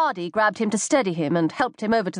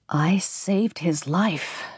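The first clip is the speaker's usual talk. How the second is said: sickly; 3 semitones lower, much slower than usual. A narrator's reading. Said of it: "Mike thought sickly".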